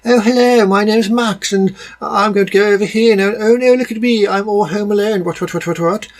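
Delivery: high-pitched mock English child; jarring English accent. Mocking the kid Max in an American movie, jarring English accent. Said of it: "his English accent was extremely jarring".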